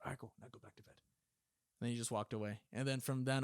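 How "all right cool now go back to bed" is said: soft, whispered retold order; imitated secretive instructor; hushed dismissal. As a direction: send him to bed under your breath. Instructor dismissing recruit at night.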